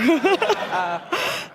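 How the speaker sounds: amused laugh at your own joke; real laughter. Real, not acted. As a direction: laugh after the mock accent. Laughs at own Southern belle impression.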